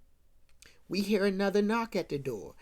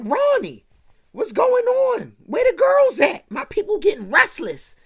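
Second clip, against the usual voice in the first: loud, much higher, angry complaint; upset storming; 12 semitones higher, 8 dB louder than usual. Retold or imitated. Upset groom yelling at Ronnie about the girls, loud and high. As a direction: fume like a groom stood up. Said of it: "like, storming".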